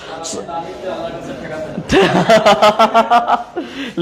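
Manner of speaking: long, loose run of laughter. Real, not acted. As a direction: laugh it out for a few seconds. Teacher laughs with the class.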